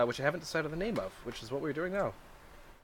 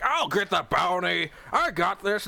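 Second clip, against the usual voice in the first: boastful eager shout, higher and slow; rowdy bounty hunter; 7 semitones higher than usual. Retold or imitated. Rowdy townsfolk bragging about the bounty. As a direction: brag like a rowdy sellsword. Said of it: "rowdy individuals who are like".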